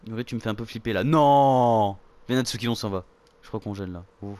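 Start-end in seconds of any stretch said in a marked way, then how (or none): 1.0-2.0 loud, drawn-out no; loud refusal. Real, not acted. Player refuses as the game gets creepy, loud cry. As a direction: belt out a long protest.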